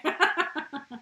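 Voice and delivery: high bright laugh; loud laughter. Real, not acted. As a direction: laugh freely.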